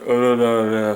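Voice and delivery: low, droning gibberish; unintelligible phone agent. Retold or imitated. Agent answers the order number, low droning gibberish. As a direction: mumble nonsense in a low monotone. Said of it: "and the person went".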